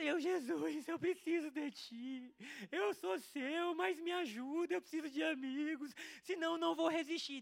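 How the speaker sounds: high, drawn-out mock wailing plea; imitated crying prayer. Acting out praying to Jesus in tears, high wailing plea. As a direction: beg slowly and loudly, acting out a sobbing youngster. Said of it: "Eu sei que eu chorava".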